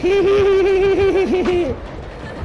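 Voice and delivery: high cackling laugh. Real, not acted. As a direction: cackle with glee. Laughing after escaping.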